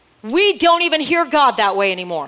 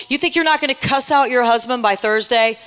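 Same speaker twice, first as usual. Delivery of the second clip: normal talking voice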